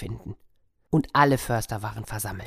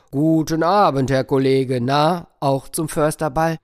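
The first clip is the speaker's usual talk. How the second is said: lightly voiced forester, a touch louder; 5 dB louder than usual. Forester greeting the bear on the path, hearty voice. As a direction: greet him like a jovial huntsman. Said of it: "der sagte mit seiner tiefen Stimme".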